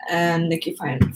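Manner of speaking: ordinary delivery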